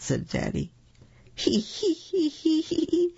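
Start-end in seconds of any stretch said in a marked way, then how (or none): 1.3-3.1 high squealing giggles; piggy giggle. A narrator's reading. Little pig giggling at silly Daddy, squealing high. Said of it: "The two little pigs snort and say".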